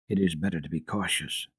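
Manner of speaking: a half-whisper, careful. A narrator's reading. Sesemann answers the doctor, mildly hushed. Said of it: "whispered Herr Sesemann".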